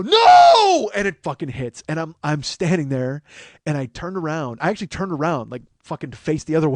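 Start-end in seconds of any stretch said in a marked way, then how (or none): 0.0-0.9 long, very high, loud wail of refusal; anguished shout. Retold or imitated. Contestant watches wheel land on bankrupt, anguished shout. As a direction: howl it in drawn-out despair.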